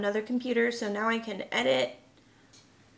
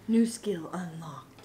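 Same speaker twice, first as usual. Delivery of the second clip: whisper; 4 semitones lower, slower than usual. Pretend. Gaming-style quip, whispered.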